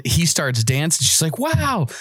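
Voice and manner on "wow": high, amazed retold praise; impressed. Officer praising the driver's dancing, impressed and high-pitched. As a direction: imitate an officer wowed by the moves.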